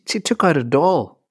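ordinary talk

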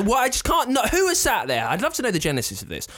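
high exasperated outburst; baffled and indignant. Real, not acted.

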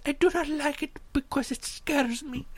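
high, small, careful voice; timid, scared lady. Retold or imitated. Passenger admitting fear to the guy, timid and high. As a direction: imitate her frightened, earnest admission. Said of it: "she's being terrorized".

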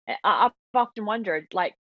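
usual speaking voice

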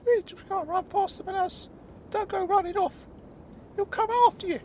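very high, worried character voice; Claude. Acted. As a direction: warn him anxiously in a squeaky voice. Claude warns about the bull.

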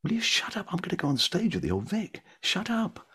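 high, exasperated hissed inner shout; nervous young actor snapping inside his head, pitched high; irritated inner voice. Retold or imitated. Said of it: "and I thought".

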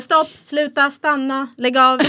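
high, loud string of commands; retold shouting. Mum telling how she orders the children off, shouting. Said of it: "Gud, vad mycket du skriker".

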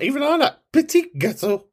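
high, showy French; mock French. Host showing off French words, high and mock posh. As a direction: say petit gâteau in a high prissy tone.

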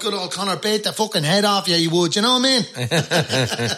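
inner-city Dublin accent. Shown as a demonstration. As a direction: brag about Conor in a broad Dublin accent. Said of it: "I'd be talking like this".

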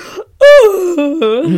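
loud, high swooning gasp; lovestruck gasp. Retold or imitated. Acting out the crab getting a kiss, high loud gasp. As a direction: squeal in a sudden gasp.

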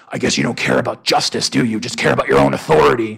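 loud, gruff imitation of Batman. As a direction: growl it like a brooding superhero. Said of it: "especially when you say it in that voice".

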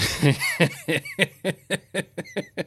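helpless high-pitched laughter; high laughing fit. Real, not acted. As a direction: crack up at yourself. Laughs at own misdeed.

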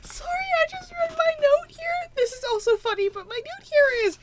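high squeaky voice through laughter; squealing, barely holding it together; cracking up. Real, not acted. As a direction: struggle to talk while helpless with laughter. Cracking up reading her own note.

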